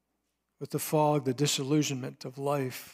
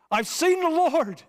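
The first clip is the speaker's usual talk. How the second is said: much higher and louder, bursting out; excited exclamation; 13 semitones higher, 6 dB louder than usual. Acted. Mary Magdalene telling the disciples, high excited exclamation. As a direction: blurt it out in a high, thrilled voice. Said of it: "I didn't do that any justice".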